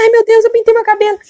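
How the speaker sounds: put-on distressed old lady. Old woman realizing her mistake before the angel, high distressed cry. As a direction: clutch your head and fret in a high old woman's voice. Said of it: "Ela colocava a mão na cabeça".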